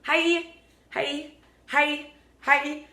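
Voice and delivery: cheery greeting. Retold or imitated. Greeting every stranger on the road, high and cheery. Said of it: "I'm like".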